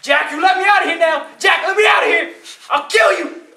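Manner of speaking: furious high, loud shouting; very angry devil. Acted. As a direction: shout threats like an enraged devil. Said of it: "the devil gets very angry".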